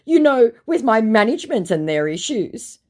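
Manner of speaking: high, loud imitation of his excuses; exaggerated put-on suitor voice; mock excuse-making. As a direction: mimic him making excuses. Mocking retelling of his excuses.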